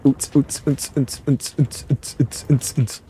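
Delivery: short rhythmic beatbox pulses; mouthed club beat. Pretend. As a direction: pump out a mock techno beat by mouth. Imitates a dance beat.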